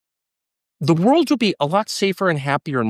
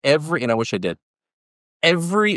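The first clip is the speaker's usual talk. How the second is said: usual speaking voice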